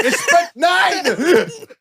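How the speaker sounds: high, slow, laughing shout; squealed through laughter; laughing exclamation. Retold or imitated. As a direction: crack up while shouting it out. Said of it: "I was like".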